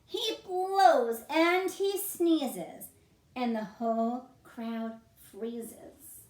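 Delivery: gentle storybook read-aloud; reading to children. A narrator's reading. Bear wakes, lively reading.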